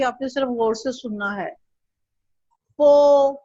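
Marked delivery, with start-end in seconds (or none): 2.8-3.3 crisp, loud model syllable पो; teacher modelling पो; clear syllable demo. Shown as a demonstration. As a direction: say पो slowly and clearly for the pupil. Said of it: "अब मैं सारा एक दफा दोबारा से पढ़ूंगी".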